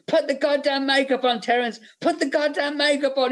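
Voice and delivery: mock yelling, pitched very high; Gale Anne Hurd. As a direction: imitate a fed-up producer yelling at the actor. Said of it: "Gale Anne Hurd was yelling at him".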